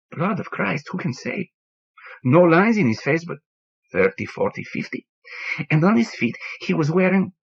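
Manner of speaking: put-on an old man mourning his lost bird, voice rising; grieving bartender Antonio.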